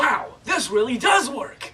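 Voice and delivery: high slow thrilled exclaim; delighted amazement. Acted. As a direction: gush about the product. Customer amazed in ad.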